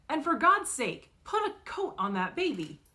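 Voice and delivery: loud, high scold that settles; scolding yell. Acted. Driver scolding the teen sister about the baby, angry. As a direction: scold her from the car. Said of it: "rolls down his window and yells".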